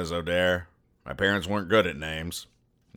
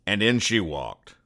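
noir detective. Acted. Noir private eye narration.

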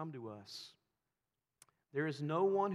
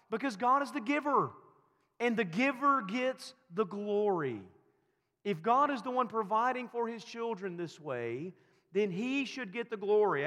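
loud, higher insistent preaching; preaching at full force; 7 semitones higher, 7 dB louder than usual. Acted. Pastor answering his own question, loud and high. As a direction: hammer the answer home.

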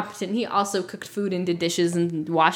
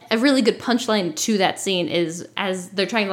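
usual speaking voice

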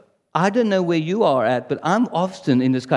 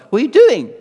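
louder, higher challenge; mock scolding; 6 semitones higher, 7 dB louder than usual. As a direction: call someone out with an exasperated question. Acts out God's challenge to him.

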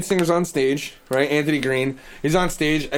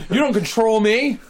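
higher mock defiance; defiant; 7 semitones higher, 3 dB louder than usual.

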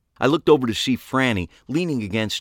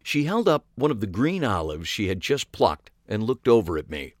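ordinary delivery

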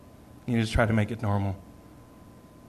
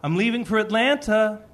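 put-on high, raised-voice call to a child; calling to him; 10 semitones higher, 5 dB louder, slower than usual. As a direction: call your kid over from across the house. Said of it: "I'm calling to him".

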